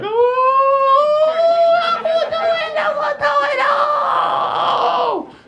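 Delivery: put-on very high, drawn-out confused yelling kid; high shrill mock kid. Comic dad acting out a kid at the headstones, high confused yell. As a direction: squeal it like a clueless kid.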